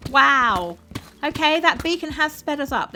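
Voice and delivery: high, loud, thrilled at the progress; excited. Real, not acted. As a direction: cheer the build's speed-up to chat. Progress on a long build.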